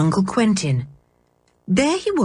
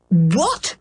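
sudden fury. A narrator's reading. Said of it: "exploded his uncle in sudden, surprising fury".

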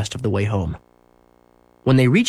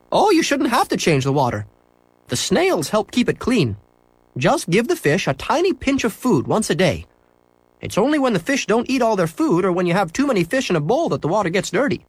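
high, friendly shopkeeper; cheerful shopkeeper agreeing; 9 semitones higher than usual. A narrator's reading. Shopkeeper to boy in pet store, high and cheerful.

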